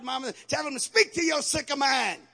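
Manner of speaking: loud, high, shouted exhortation; rally the mothers with full force; preaching at full force. Real, not acted. Preacher urging mothers to have their kids speak faith, shouting.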